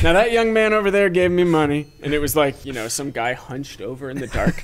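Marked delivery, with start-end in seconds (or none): 0.0-1.9 raised, louder imitation; loud acted quote. Alley man addressing onlookers, loud acted quote. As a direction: proclaim it as the odd man in the story.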